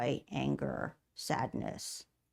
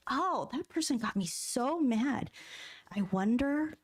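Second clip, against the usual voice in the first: slow, wondering self-talk, pitch raised; curious inner voice; 5 semitones higher than usual. Shown as a demonstration. Coach voicing a curious inner voice to the audience. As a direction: muse it aloud slowly as if puzzling it out. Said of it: "just get really curious".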